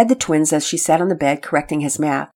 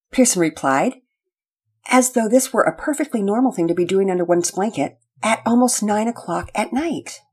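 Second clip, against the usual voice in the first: ordinary delivery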